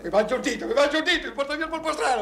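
high, loud frantic wail; hysterical. Acted. Distraught man raving to his friend, hysterical. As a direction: rave in despair.

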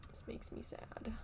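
soft sad whisper. Real, not acted. Whispered aside.

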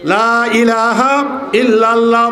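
slow recitation. Shown as a demonstration. Preacher recites the kalima.

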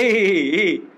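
long, squealed-out fake "hee hee"; mock giggle. Teacher joking that laughter is coming, mock giggle. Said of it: "हंसी आ रहा है".

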